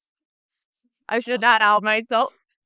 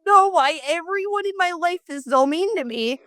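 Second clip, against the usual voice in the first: high, loud mock complaint; exaggerated poor-me protest, pitched way up; mock self-pity; 9 semitones higher, 5 dB louder than usual. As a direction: play the wronged victim, hammed-up. Mocking own self-pitying response.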